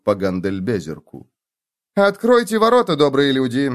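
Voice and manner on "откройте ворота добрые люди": high, loud, snooty nasal command; haughty nasal voice. Acted. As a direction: play a pompous nobleman ordering peasants. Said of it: "прогнусавил Феликс с самым высокомерным голосом".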